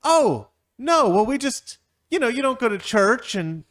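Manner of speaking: high, loud, apologetic squeal; awkward couple voice. Acted. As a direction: squeal out a nervous excuse.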